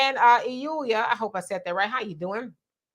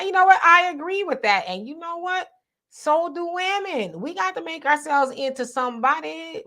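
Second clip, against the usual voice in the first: raised, animated push of the point; emphatic agreement; 6 semitones higher than usual. Real, not acted. Host firing up the chat about women, emphatic. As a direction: pump it up for the women too.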